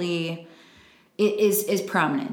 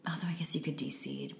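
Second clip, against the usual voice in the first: soft whispered afterthought; whispered aside; 3 semitones lower, 11 dB quieter than usual. Real, not acted. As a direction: drop to a whisper, half to yourself. Whispered aside about de-seeding.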